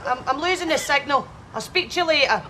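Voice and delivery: ordinary delivery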